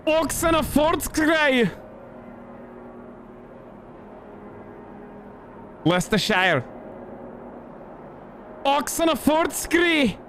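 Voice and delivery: very high, slow, drawn-out silly voice; mock name reading. Streamer reading out strange names in a high, slow put-on voice. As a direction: draw each name out high, in hammed-up grandeur.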